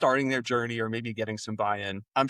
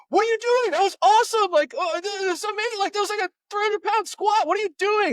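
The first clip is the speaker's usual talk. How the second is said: very high, loud squeaky cartoon excitement; hammed-up falsetto disbelief at a great squat; mock amazed squeal; 5 dB louder than usual. Coach to client who dropped the weight, squealing in mock amazement. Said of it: "I'm like".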